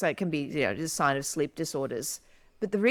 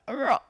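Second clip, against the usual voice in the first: demo yawn; 8 semitones higher than usual. Shown as a demonstration. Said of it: "it's like".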